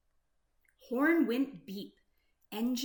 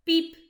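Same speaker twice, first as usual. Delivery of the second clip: high, much louder beep; horn beep; 6 semitones higher, 11 dB louder than usual. Acted. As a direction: toot like a truck horn. Blue's horn in story.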